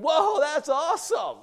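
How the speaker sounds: high, excited outburst; wowed and high-pitched; amazed reaction. Acted. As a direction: burst out in amazement, loud on the first word. Acting amazed at the miracle.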